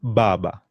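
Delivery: Baba with emphatic b sounds; Baba, firm hard b. Shown as a demonstration. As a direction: press a little on each B in Baba. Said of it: "they have a bit of emphasis on them".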